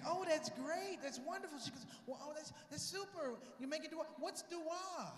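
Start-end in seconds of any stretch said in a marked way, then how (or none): 0.1-1.6 high, gushing lady voice. Retold or imitated. Lady passenger praising his answer, high gushing voice. 2.1-5.1 high lady voice; gushing, clueless lady. Retold or imitated. Lady passenger puzzled by the word dua, high polite voice.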